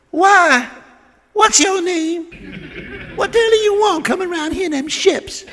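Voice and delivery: put-on high, sassy girl's voice. Girl on the beach sassing Chris from shore, in a high sassy voice. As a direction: talk back like a bold young girl.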